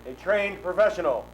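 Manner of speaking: booming, grand ringmaster voice; grandiose flea circus showman. Acted. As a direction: announce yourself like a circus showman.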